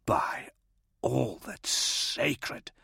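slow, hushed whisper; breathed oath. A narrator's reading. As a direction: breathe it out softly, drawing out the words. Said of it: "Gaunt breathed".

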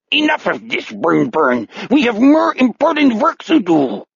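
put-on very high alien German accent; second personality's eerie German-like accent; weird accent. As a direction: scold in a high, odd pseudo-German voice. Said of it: "switches to the weird accent and replies".

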